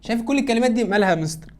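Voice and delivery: quick pupil question. Retold or imitated. Teacher voicing a pupil.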